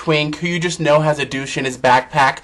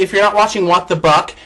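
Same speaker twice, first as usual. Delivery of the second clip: ordinary delivery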